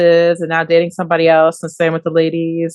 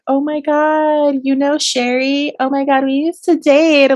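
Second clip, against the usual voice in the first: higher, slow gushing "oh my God"; gushing gossip voice; 7 semitones higher than usual. Retold or imitated. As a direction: play the excited ex who knows everyone.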